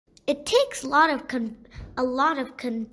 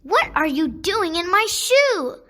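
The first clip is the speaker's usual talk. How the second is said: screaming at the toad; 5 semitones higher, 6 dB louder than usual. A narrator's reading. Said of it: "Asiya screams at what seems".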